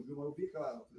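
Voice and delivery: ordinary talk, nothing marked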